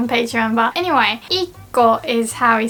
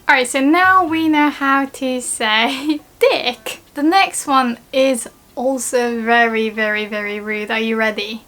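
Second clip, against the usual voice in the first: ordinary talk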